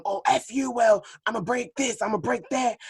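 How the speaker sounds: high, hammed-up quarrel voice; mock argument. Mocking a toxic couple fighting, high hammed-up voice. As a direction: parody a screaming couple. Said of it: "if it's gonna be some".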